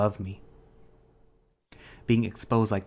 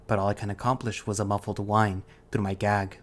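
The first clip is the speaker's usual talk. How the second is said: ordinary delivery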